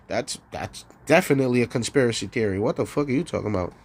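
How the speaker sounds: low, fast brush-off voice; mock dismissive official. Voicing the authorities brushing off the question, low and fast. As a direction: rattle off the denial low and quick.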